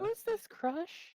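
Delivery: very high, slow tiny voice; high squeaky voice. Acted. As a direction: squeak it slowly. Keeps the squeaky character voice.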